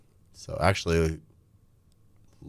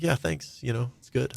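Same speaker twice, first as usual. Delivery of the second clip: fake cheerful reply; 7 semitones higher than usual.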